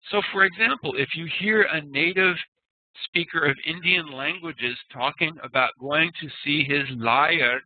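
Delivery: ordinary talk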